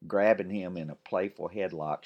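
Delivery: ordinary delivery, nothing marked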